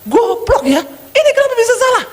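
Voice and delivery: high-pitched acted tirade; enraged boss. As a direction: blow up at an employee, voice leaping up. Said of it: "Kalau dulu dia ngamuk".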